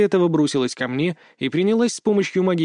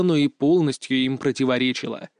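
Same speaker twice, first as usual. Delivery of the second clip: usual speaking voice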